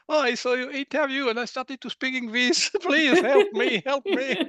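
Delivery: high, loud, laughing plea; laughing mock cry for help. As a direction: ham up a panicked caller, giggling. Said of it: "And then they say".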